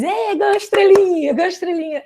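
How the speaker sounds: put-on high, loud childish cheer for mom; kids cheering. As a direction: chant it back like her kids praising her. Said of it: "e eles".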